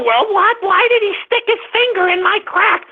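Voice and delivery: high mocking impression of an indignant woman. Host mocking a woman asking why she was groped, high mock voice. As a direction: mimic her outrage with a sneer.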